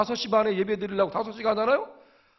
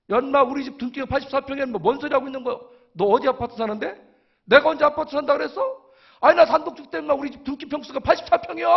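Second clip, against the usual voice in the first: higher, indignant comeback; retold indignant argument; 6 semitones higher, faster than usual. Arguing with a friend over the size of his house, indignant. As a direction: retort like you're being wrongly doubted.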